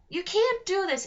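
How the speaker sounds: yelled. Acted. Alex protesting to her captors, yelling. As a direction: yell it in outrage. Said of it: "Alex yelled".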